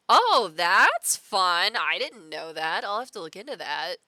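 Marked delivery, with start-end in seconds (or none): none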